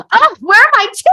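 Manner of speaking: high, loud mock girlfriend voice; mock Parker Posey. Girlfriend cuts off his heartfelt line, high loud mock voice. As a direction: pipe up high and loud, cutting him off. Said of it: "Parker Posey just goes".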